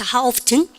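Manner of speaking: "often" with an audible T, "off-ten"; dialect T in often. Shown as a demonstration. Teacher tries the awkward dialect form, T sounded in "often". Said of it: "사투리예요".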